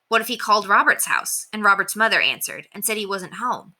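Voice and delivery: usual speaking voice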